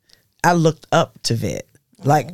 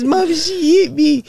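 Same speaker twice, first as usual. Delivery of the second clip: high whiny tattling; mock high child complaining to mom; whining kid; 10 semitones higher than usual. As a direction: whine it up high like a hurt kid.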